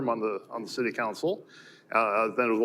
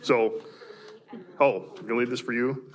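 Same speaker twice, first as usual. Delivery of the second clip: ordinary talk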